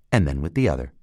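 usual speaking voice

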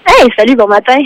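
loud cheerful greeting; booming hello into the phone. Real, not acted. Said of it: "Tabarouette, t'es fort".

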